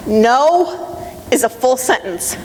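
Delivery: slow, each word weighed; a slow, deliberate motto; slow emphatic statement. Shown as a demonstration. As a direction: lay it down firmly, word by word. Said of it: "Say it with me".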